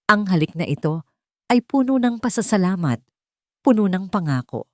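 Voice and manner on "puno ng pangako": ordinary delivery, nothing marked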